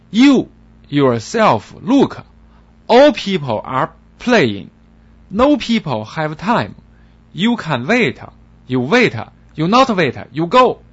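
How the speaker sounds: loud, slow angry broken English; furious receptionist. Acted. Glaring receptionist loudly scolding the waiting foreigner. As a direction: scold him hard. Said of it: "前台小姐怒目圆睁".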